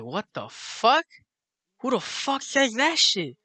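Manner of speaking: slow, high-pitched incredulous question; baffled disbelief. Retold or imitated. Friends reacting to her odd phrase, incredulous. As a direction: stretch each word in mock astonishment.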